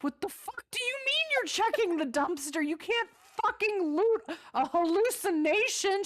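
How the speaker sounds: high, loud disbelieving shout; exasperated yell. Retold or imitated. Gamer yelling at her partner for looting. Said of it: "I'm like".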